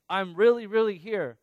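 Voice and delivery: loud, high, insistent assurance; emphatic insistence. Retold or imitated. Jesus to doubting disciples, insisting emphatically. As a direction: push it out so they believe it.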